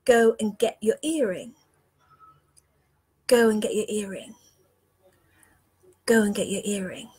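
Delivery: soft inner whisper, fading; still small voice. Retold or imitated. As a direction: murmur it like a voice inside your head. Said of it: "I get this still small voice saying".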